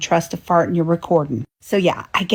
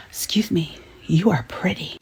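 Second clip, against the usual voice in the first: whisper; 3 semitones lower, 6 dB quieter than usual. Retold or imitated. A stranger at the grocery store.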